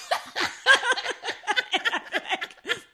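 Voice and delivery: loud, high cackle; real burst of high laughter; cackling laugh. Real, not acted. Co-host cracking up after imitating her ex, cackling laugh.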